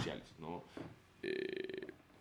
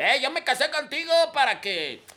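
high, loud mock macho scolding; mock a jealous husband; hammed-up jealous husband; 9 dB louder than usual. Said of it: "decirle a su esposa".

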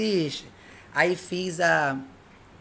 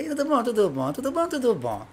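mock cheery greeting; 5 semitones higher, 3 dB quieter than usual. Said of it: "brinco com você".

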